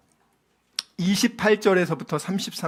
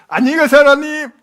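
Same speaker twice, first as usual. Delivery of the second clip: mock farewell; 7 semitones higher, 8 dB louder, faster than usual. Sarah's farewell acted out.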